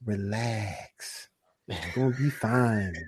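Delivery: soft, low "relax, it's gonna be fine"; quietly reassure, like a calm quarterback; calm reassurance. Retold or imitated. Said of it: "in the words of Aaron Rodgers".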